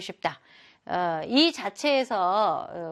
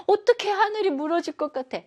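much higher, shocked disbelief; 10 semitones higher than usual. Acted. Teacher voicing a shocked girl's disbelief. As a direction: ask it like the sky really might fall. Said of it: "굉장히 충격받았을 때".

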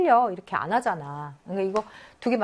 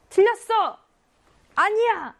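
sharp shouted rejection, much higher and louder; harsh rebuke; 12 semitones higher, 5 dB louder, slower than usual. Shown as a demonstration. Teacher demonstrating a harsh Korean-style correction, shouting. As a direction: snap it like scolding a wrong answer. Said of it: "좀 너무 가혹하다".

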